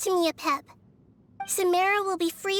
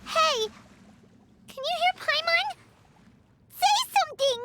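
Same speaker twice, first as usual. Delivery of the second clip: high urgent calls; calling out; 10 semitones higher than usual. Acted. Paimon calling out to silent fungi, urgent and high. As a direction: shout to get an answer.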